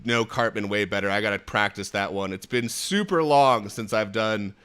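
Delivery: ordinary delivery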